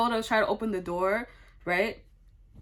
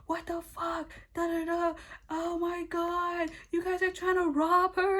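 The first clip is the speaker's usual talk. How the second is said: high, thin imitation of a panicked yell; mock yelling; 9 semitones higher, 4 dB quieter than usual. Roommate thinks they are robbing her, mock high yelling. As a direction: hold back the volume, pitch the yell way up. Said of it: "then she starts yelling".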